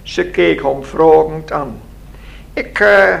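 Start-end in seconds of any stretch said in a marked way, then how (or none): 2.5-3.1 high squeaky little man, lightly voiced; piping little man. A narrator's reading. Little man introduces himself to Lina, piping. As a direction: pipe up in a higher voice. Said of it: "piept het mannetje".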